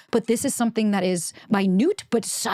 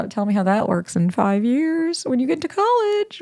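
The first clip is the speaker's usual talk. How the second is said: slow, knowing sarcasm, pitched up; older friend humouring a lovestruck teen; sarcastic doubt; 5 semitones higher than usual. Retold or imitated. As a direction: humour her with patronising disbelief. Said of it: "And you're like".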